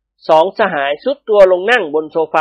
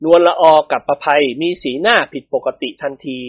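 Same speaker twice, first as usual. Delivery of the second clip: plain reading voice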